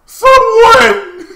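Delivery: hammed-up high scream; mock cry for help. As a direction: wail loudly as a pretend victim.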